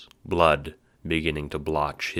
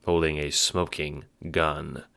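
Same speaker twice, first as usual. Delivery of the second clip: normal talking voice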